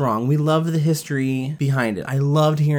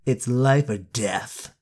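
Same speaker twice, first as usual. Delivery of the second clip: dramatic; 3 semitones lower, slower than usual. Acted. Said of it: "I'm so dramatic".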